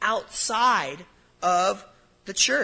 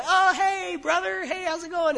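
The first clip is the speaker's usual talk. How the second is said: much higher, bright fake-friendly hello; mock cheery churchgoer greeting; 11 semitones higher than usual. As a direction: play the shallow Sunday greeter, sing-song and high. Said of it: "we say".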